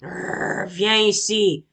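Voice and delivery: growling bear; angry growl. Acted. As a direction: growl at the fleeing bee. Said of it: "L'ours est de plus en plus en colère".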